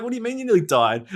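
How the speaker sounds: high, quick incredulous question; retold disbelief. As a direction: re-enact alarmed disbelief. Retold alarmed reaction to a friend.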